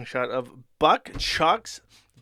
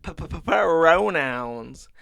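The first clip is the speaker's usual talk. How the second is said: louder, stammering p-p-p, teeth gritted; stuttering gush through gritted teeth; 3 semitones higher, 7 dB louder than usual. Retold or imitated. Comic Joker gushing into the mic, mock gritted-teeth stutter. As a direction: spit the word out through clenched teeth in a hammed-up stutter. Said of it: "gushes through gritted teeth into the microphone".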